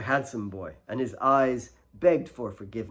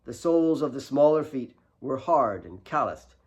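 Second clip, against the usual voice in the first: usual speaking voice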